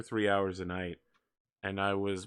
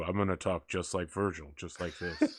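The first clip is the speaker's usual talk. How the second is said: pitched-down imitation of Virgil; deep voice like Virgil; 3 semitones lower, 4 dB quieter than usual. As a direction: drop the voice to match his. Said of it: "lower your voice and pitch to match mine".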